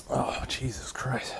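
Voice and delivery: slow breathless whisper of pain. Real, not acted. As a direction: barely get it out under your breath. Burning mouth, quiet pained whisper.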